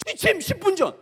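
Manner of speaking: high, loud barrack call; mock drill call. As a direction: call it out like a barracks duty officer. 아버지 점호 구령.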